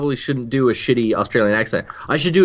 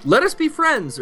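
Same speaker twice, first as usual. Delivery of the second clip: higher mock mom saying it; imitating his mom; 12 semitones higher than usual. Son quoting his mother's pet phrase, mock mom voice. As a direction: parrot his mother's stock phrase, pitched up. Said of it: "she would always say".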